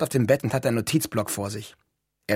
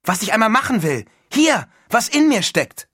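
high, loud burst of enthusiasm; excited; 10 semitones higher, 7 dB louder than usual. A narrator's reading. Neil reveals his ambition to his roommate, excited. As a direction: announce it eagerly, drawing out the key words.

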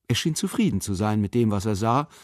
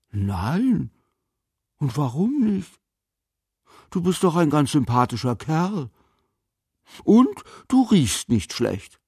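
lightly voiced old man, curious and higher; a hint of a puzzled old man, pitched up; 6 semitones higher than usual. A narrator's reading. Old man questions the boy.

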